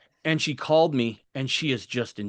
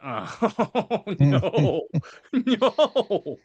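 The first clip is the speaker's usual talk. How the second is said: high laughing "oh no, no"; pitched-up laughing protest; laughing refusal; 6 semitones higher, 4 dB louder, much slower than usual. Retold or imitated. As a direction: squeal out a laughing no. Comic reluctant reaction, laughing.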